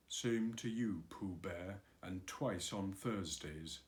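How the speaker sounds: low, quiet, gloomy donkey reply. Acted. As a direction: grumble it, mildly gloomy. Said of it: "said Eeyore gloomily".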